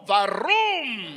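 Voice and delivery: rolled-r engine noise; accented car sound. Shown as a demonstration. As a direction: make a toy car noise with a Mexican accent. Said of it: "put an accent on a sound effect".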